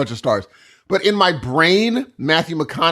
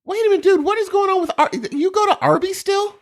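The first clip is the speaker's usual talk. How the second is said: high-pitched incredulous questioning; mock disbelief; faster than usual. Questioning co-host about still eating at Arby's, mock disbelief. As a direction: call out a friend in comic shock. Mock shock at co-host's confession.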